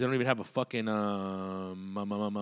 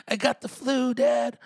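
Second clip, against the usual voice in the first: high-pitched cartoon boy voice; put-on sick kid, pitched way up; Bobby; 12 semitones higher, 5 dB louder, slower than usual. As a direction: whine it like a little cartoon son. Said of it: "Talking like fucking Bobby from fucking, um, King of the Hill".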